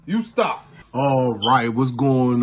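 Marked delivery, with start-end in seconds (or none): none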